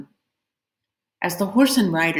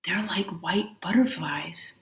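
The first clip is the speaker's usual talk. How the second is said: soft breathy whisper of wonder; thinking aloud, whispered; 6 dB quieter than usual. A narrator's reading. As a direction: whisper it to yourself in awe. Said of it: "Pinmai thought".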